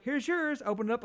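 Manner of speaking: mock cheery coworker. Coworker handing sandwich.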